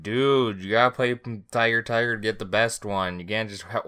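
loud excited friend voice; imitating Beck. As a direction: shout it like a pushy buddy. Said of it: "Beck's gonna be like".

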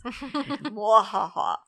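slow, drawn-out villain cackle; mock evil laugh. Historian sending fans off to study, mock evil laugh. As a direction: gloat with a playful villain's laugh.

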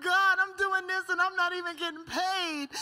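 high mock protest to God; acted-out griping in a high pitch; retold complaint. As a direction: complain upward, hammed-up and indignant. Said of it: "I finally was complaining to the Lord".

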